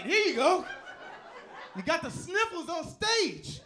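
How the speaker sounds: high-pitched shouting at the stage; high, exasperated comic yell; mock heckling holler. Acted heckling of shivering stripper.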